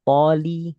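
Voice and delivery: Poli said loud and clear; stressed poli. Shown as a demonstration. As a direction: model the syllable Poli firmly.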